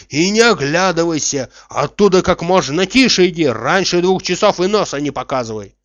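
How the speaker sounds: loud, urgent commands; shouting after someone. A narrator's reading. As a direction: keep calling instructions after the runner. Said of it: "кричал он ей вслед".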